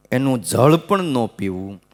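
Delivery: normal talking voice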